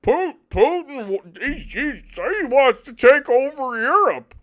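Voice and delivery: high, loud mocking whine; mocking dupe. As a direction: parrot the gullible line in a silly voice. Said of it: "we're all supposed to just be like".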